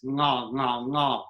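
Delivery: NGA from the back of the mouth; back-of-mouth NG sound. Shown as a demonstration. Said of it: "that space".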